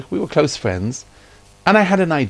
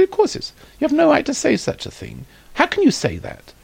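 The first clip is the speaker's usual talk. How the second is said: high-pitched, indignant reproach; outraged protest, acted at half volume; retold angry scolding. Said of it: "And my wife screamed at me".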